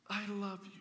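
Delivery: put-on a shy girlfriend whispering her love; tender confession. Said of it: "she looks tenderly at him and says".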